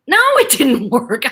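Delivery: slow, gleefully emphatic no; emphatic daughter. Retold or imitated. Daughter confirming to dad his tantrum failed, emphatic. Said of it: "I'm, like, looking at him".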